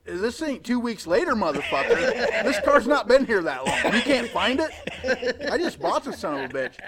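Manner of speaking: mock furious yell about a missing car; angry retold rant. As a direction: yell it out half laughing.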